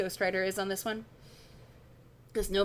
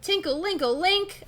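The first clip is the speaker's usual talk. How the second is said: high, sing-out glass noises; tinkling sound words; 9 semitones higher than usual. Acted. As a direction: imitate tinkling glass on raised pitch. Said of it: "that's an onomatopoeia".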